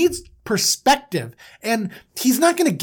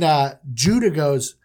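usual speaking voice

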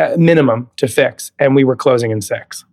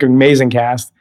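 ordinary talk, nothing marked

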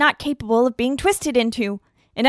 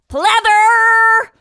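high, loud shout across the room; calling out; 6 semitones higher, 5 dB louder than usual. Acted. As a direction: call her over loudly. Calling out to [name].

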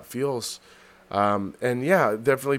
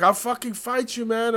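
mock threat; 10 semitones higher than usual. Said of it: "he's like".

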